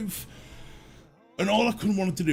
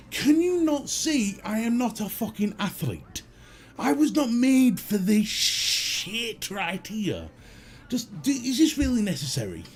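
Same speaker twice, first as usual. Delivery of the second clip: exasperated whine; 6 semitones higher than usual. Retold or imitated. Moaning about the long walk.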